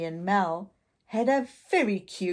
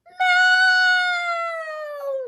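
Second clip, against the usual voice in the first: high loud falling scream; girl screaming for her sister mid-fall; 8 dB louder than usual. Acted. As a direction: scream her sister's name as you drop. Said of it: "she screamed as she fell".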